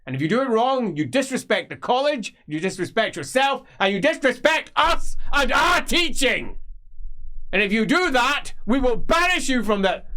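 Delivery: high, loud tirade; high and loud, building fury; ranting old magister. Acted. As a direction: berate the student for disrespect. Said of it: "ranting magister".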